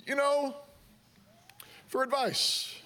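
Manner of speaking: Johnny's wonderful sung up high and sweet; mock sugary parent. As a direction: pretend all is well, sweetly. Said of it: "the point isn't to say".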